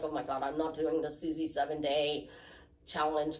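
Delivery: low, monotone complaint; reluctant grumbling. Acted. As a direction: voice a dreading participant, low and subdued. Voices a reluctant listener's complaint.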